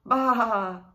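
loud crying baa; crying bleat. A narrator's reading. Little lamb crying out near the hidden wolf. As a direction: cry out like a frightened lamb. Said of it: "cried the little lamb".

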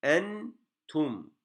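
"Entum" as a slow two-part reading demo; pausing on nun and mim. Shown as a demonstration. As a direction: rest on n, then on m in "Entum". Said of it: "hem mimde duruyoruz".

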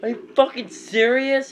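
high, loud furious disbelief; real gamer rage at a flawless; outraged. Real, not acted. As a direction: yell it at the screen. Player loses to opponent.

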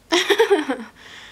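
high bright burst of laughter; delighted laugh. Real, not acted. Delighted at face filter.

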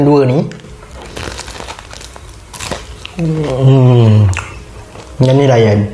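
talking between bites; muffled by food in mouth; mouth full, chewing. Real, not acted. Seen 0: Cheeks full, chewing a bitten chocolate piece while humming and speaking.

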